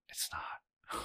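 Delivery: whispered under the breath; hushed aside. Real, not acted.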